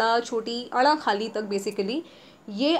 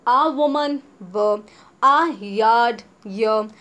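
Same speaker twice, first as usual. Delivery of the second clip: a woman drawn out, louder; very slow model; 5 dB louder, much slower than usual. Shown as a demonstration. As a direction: stretch a woman slowly for students. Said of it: "teacher modelling examples".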